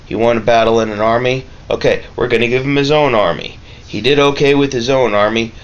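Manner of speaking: usual speaking voice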